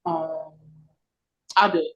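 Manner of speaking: ordinary talk, nothing marked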